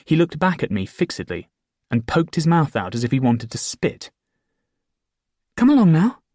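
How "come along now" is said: coaxing. Acted. Beckoning the fool again.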